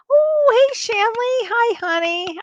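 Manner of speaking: high, delighted hello to a friend; excited greeting. Real, not acted. Greeting a viewer on livestream.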